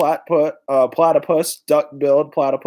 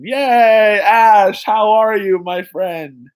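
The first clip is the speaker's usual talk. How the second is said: high, loud happy hello; excited greeting; 6 semitones higher, 5 dB louder, much slower than usual. Real, not acted. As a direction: cheer as a friend arrives. Excited greeting to arriving viewer.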